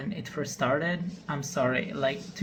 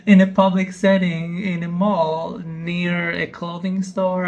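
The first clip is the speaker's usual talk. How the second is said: loud, raised-pitch incredulous emphasis; loud disbelief; 3 semitones higher, 10 dB louder than usual. Real, not acted. Reactor doubting it happened in a mall, loud disbelief. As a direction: hammer each phrase in loud disbelief.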